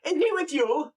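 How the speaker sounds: high-pitched, nosy Scottish countryman; Scottish farmer voice pitched well up. Acted. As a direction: call out as a surprised Scottish farmer. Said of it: "like a Scottish person or whatever".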